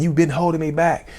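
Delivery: high-pitched blaming rant; accusing, pitch held way up; retold yelling. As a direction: reenact an angry tirade, moderate volume. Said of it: "Screaming and yelling at my son".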